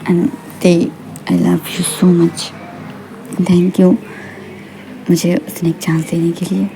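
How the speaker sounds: soft, slow, heartfelt declaration; quiet, lingering tenderness; tender love confession. Acted. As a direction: murmur grateful love to a partner. Acted husband declares love to wife.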